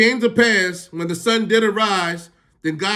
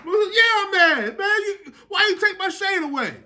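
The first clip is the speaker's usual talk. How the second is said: whining Jonah; 13 semitones higher than usual. Acted. Jonah complaining to God about his shade, very high and whiny. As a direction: stretch out a childish, high-pitched gripe.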